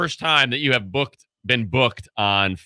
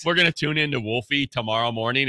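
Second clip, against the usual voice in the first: ordinary talk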